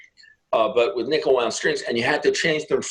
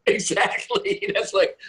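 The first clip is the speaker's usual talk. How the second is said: laughing; 9 semitones higher, slower than usual. Real, not acted. Laughing at sub story.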